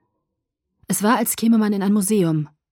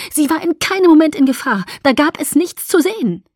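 much louder, higher heated reply; heated retort; 9 semitones higher, 8 dB louder than usual. Acted. Alice arguing back at Edward, heated. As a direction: shout her defense back.

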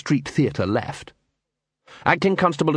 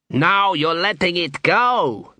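slow, higher-pitched moan; moaning dwarf; 6 semitones higher, slower than usual. A narrator's reading. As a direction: drag it out as a complaint. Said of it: "moaned a dwarf".

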